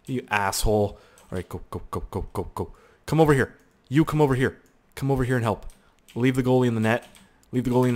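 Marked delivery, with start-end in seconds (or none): none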